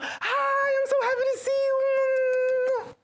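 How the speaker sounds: high, loud, slow, overdone cheer; mock gushing hello. Greeting a friend she wouldn't greet this way, mock gushing. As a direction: gush like you're thrilled to see him.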